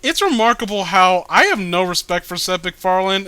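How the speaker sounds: usual speaking voice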